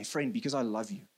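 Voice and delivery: soft, low, gentle plea to a friend; loving. Acted. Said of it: "but in a loving way".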